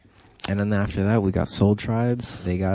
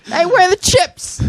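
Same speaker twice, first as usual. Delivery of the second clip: silly put-on voice; 8 dB louder than usual. Mock voice after cabinet joke.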